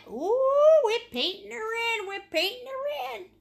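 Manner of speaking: high, loud and delighted; excited. Real, not acted. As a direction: sing out the painting moment. Excited while painting sail.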